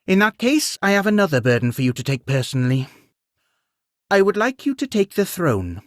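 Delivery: fast, like a merchant naming prices; driven. A narrator's reading. Queen to Beowulf, turning businesslike: driven and fast. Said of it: "Her tone changed, becoming suddenly more driven".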